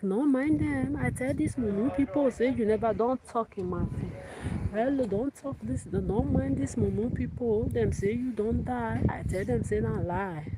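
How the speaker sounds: high-pitched Pidgin-speaking mother figure; imitation of kitchen woman. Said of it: "This is how she usually talks".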